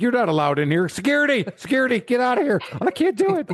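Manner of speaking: high, panicked call for security; scared homeowner calling security over a deer; mock panic. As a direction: squeal for help in hammed-up fright. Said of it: "I'm like".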